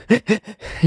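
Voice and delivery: high mock sobs; imitated crying. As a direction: imitate someone sobbing, without words. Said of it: "she's crying".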